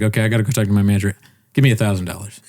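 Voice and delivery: fast, offhand money demand; rushed salesman quote. Retold or imitated. Imitating the car salesman asking for a thousand, rushed. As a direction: rattle it off like it's nothing. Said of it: "Literally, that's how he says it".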